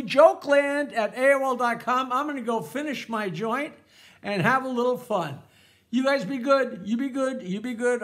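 ordinary delivery

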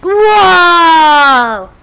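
loud drawn-out amazed whoa; excited whoa. Real, not acted. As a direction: call out in amazement. Reacting to camera view.

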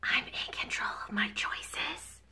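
soft unvoiced whisper. Shown as a demonstration. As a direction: whisper it like a secret. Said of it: "let's try it in a whisper".

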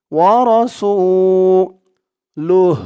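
slow "ওয়া রসুলুহ" with a long held "সু"; one-alif elongation. Shown as a demonstration. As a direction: stretch the "সু", then close on a short breathed h. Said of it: "এক আলিফ টান দিয়ে পড়তে হয়".

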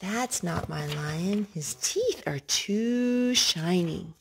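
ordinary talk, nothing marked

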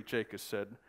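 plain reading voice

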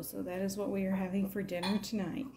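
ordinary talk

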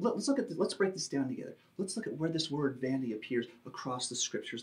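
ordinary talk, nothing marked

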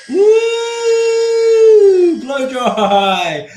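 high, loud playful "Woo!"; gleeful game whoop; excited whoop. Real, not acted. Cheering while blow-drying in game.

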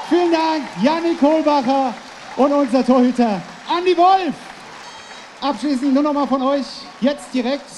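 laut zum publikum gerufen; hallen-moderator. Real, not acted. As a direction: heiz die halle an wie ein event-moderator. Hosts crowd on stage. (lauter hallenmoderator)

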